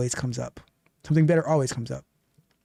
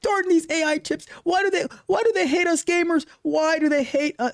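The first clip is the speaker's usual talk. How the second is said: high-pitched, hammed-up gamer lament; mock pouting; 14 semitones higher than usual. Host mimicking viewer upset over Steam prices, mock pouting. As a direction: imitate a pouter wailing at the injustice. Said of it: "the thing you were pouting about".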